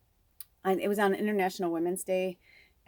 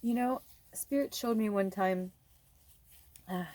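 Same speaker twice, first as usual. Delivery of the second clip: still teary; 2 semitones higher than usual. Real, not acted. Starting the Spirit story to viewers, still tearful. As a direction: let the tears catch the words. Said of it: "I'm wiping my tears".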